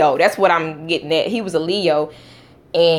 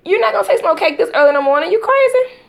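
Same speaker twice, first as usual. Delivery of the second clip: scolding; 10 semitones higher, 3 dB louder than usual. Real, not acted. Mother scolding child who wants cake, fast and sharp.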